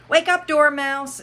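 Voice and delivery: calling out. Acted. Waking the sleeping dormouse.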